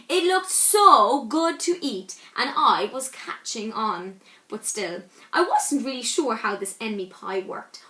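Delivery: usual speaking voice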